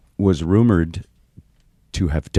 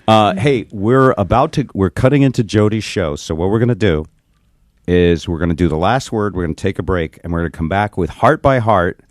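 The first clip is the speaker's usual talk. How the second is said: normal talking voice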